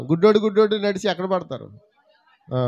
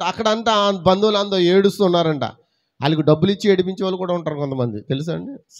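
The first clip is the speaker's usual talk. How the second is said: normal talking voice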